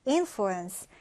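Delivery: "influence" stressed on IN; stress on first syllable. Shown as a demonstration. Teacher repeats the word for learners, first-syllable stress on influence. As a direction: hit the first syllable of influence, let the rest fall away. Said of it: "첫 음절의 강세를 두고요".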